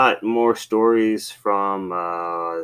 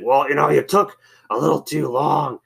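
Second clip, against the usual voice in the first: cranky coworker imitation; 6 semitones higher than usual. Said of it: "cranky. Like, whenever he".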